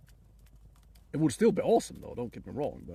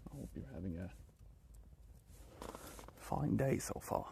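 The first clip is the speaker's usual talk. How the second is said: soft well-wishing; 3 semitones lower, 9 dB quieter than usual. Real, not acted. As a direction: wish them well gently. Gentle wish to follower.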